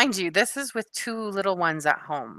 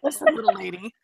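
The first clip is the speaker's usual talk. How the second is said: lightly raised, slightly put-on voice for the movie line; mock movie line; 7 semitones higher than usual. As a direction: say it again as a gentle hammed-up quote.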